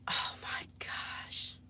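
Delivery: slow breathless whisper; mortified whisper. Real, not acted. Streamer to her audience, whispering in embarrassment. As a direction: hide in the words, barely voiced.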